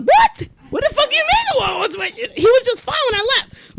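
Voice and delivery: high, laughing disbelief; shocked disbelief. Retold or imitated. Wife retelling her shocked reply to husband's text, high and laughing. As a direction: react like the news can't be real.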